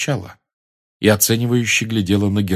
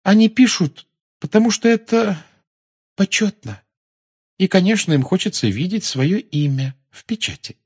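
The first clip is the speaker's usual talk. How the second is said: high, halting musing; wistful, high-pitched girlish voice; dreamy girl; 12 semitones higher, 3 dB louder than usual. A narrator's reading. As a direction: float through the thought with airy pauses. Luna continues in her dreamy voice.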